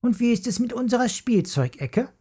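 put-on high, fast indignant girl's voice; complaining. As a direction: whine it out quickly. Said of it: "beschwerte sich Jula".

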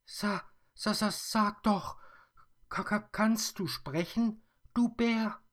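put-on very high, loud stammering girl; frightened stammer. Mia is startled the bear speaks, stammering very high. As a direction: stutter in shock. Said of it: "fragt noch einmal".

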